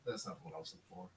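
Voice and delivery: very soft low mutter; muttered aside. Real, not acted. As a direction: say it under your breath to yourself. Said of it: "GM muttering to himself".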